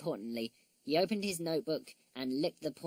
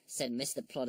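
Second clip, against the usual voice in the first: plain reading voice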